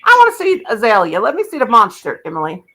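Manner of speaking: ordinary delivery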